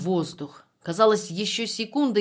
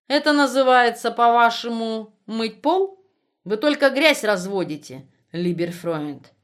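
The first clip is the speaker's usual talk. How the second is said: sneering, loud and higher; snide mocking question; 4 semitones higher, 6 dB louder than usual. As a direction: jeer at him with contempt. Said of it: "ехидно спросил". (ехидно, насмешливо)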